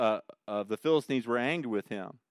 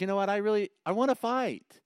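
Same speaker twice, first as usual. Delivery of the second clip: eager David; 9 semitones higher than usual. Retold or imitated. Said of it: "So David says".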